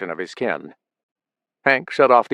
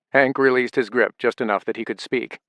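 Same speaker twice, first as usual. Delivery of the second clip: usual speaking voice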